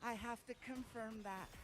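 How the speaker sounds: soft, polite imitation of a firm nurse; quiet, careful nurse voice; nurse insisting. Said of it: "she's like".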